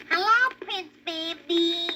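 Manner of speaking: slow high drawn-out greeting; opossum voices. A narrator's reading. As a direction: stretch the hello lazily.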